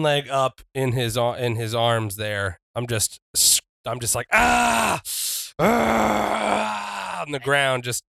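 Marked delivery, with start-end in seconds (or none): 4.3-7.2 long high cry of pain; pained yell. Acted. Wrestler caught in an ankle lock, pained yelling. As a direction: yell out in drawn-out agony.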